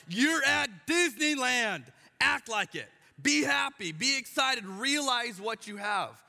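loud, high, slowed scolding; fed-up dad telling kids in timeout to be happy; exasperated parent. Retold or imitated. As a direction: spell it out to sulking kids. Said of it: "it's like".

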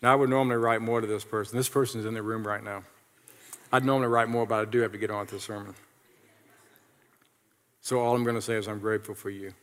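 low, soft talking-to-himself; muttered aside. Real, not acted. Preacher talking as he writes a thank-you note, muttered aside. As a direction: murmur quickly while busy writing.

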